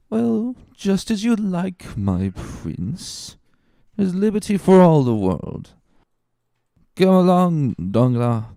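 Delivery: put-on high, wheedling drunk voice; tipsy coaxing. Drunk Caderousse coaxing Fernand to come along, slurred. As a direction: coax him along, slurring.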